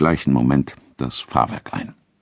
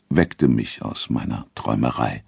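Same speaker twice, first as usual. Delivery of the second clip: ordinary delivery, nothing marked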